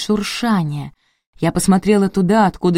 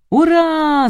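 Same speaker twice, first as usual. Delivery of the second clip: high, long cheering hurrah; jubilant yell; 11 semitones higher than usual. A narrator's reading. Nadezhda celebrates the success, yelling jubilantly. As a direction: stretch out a high-pitched whoop. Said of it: "заорала Надежда Михайловна".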